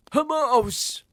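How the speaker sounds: high, loud panicked shout. A narrator's reading. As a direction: cry out in alarm. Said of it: "They were shouting".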